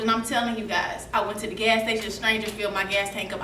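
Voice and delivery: ordinary delivery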